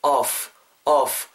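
"off" ending in a long blown F; rabbit-teeth F. Shown as a demonstration. Teacher drilling the F sound, "off" with a breathy F release. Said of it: "윗니 아랫입술을 내쉬고".

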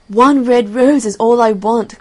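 loud, high impassioned plea; pleading cry. A narrator's reading. Nightingale begs the barren tree, loud high cry. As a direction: cry it out, voice lifted. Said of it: "cried the nightingale".

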